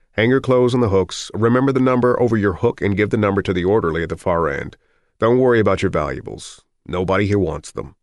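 doctor herding applicants along; brisk doctor giving orders. Acted. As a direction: bark routine instructions at a line of men.

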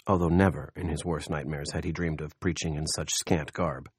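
plain reading voice

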